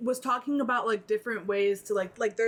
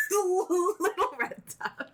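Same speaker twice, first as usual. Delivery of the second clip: higher, slow laughing repeat of a flub; 9 semitones higher, slower than usual. Real, not acted. Co-host laughs while repeating her friend's slip. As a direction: giggle the mistaken name out.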